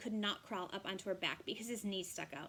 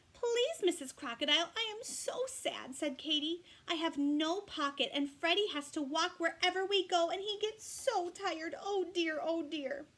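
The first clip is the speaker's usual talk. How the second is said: high, pleading, tearful kangaroo voice; crying Katy; 6 semitones higher, 5 dB louder than usual. Acted. Katy begging Missus Crocodile, high and tearful. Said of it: "she started to cry again".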